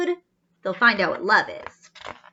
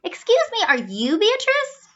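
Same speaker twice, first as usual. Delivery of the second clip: put-on eager young robot; 10 semitones higher than usual. Z asks around.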